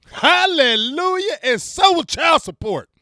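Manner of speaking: high, loud, slow, preacher-style hallelujah; jubilant hallelujah. Real, not acted. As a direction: belt it like church praise. Celebrating end of child support.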